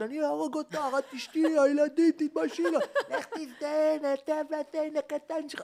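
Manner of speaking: high-pitched mock screaming match; screaming at each other. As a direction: shriek the threats like a couple fighting. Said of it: "הם צורחים אחד".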